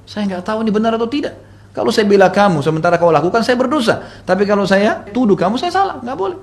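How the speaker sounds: high-pitched, distressed explaining; agitated helplessness. Retold or imitated. Mother answering her accused daughter, agitated helplessness.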